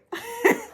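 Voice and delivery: a bright, high burst of giggling; excited giggle. Real, not acted. Giggling to viewers over her new package.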